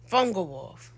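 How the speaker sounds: ordinary talk, nothing marked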